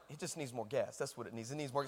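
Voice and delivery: very low, hushed aside; muttering to himself. Retold or imitated. Boy reasoning with himself over dead fire, low mutter. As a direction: mutter it under your breath while plotting.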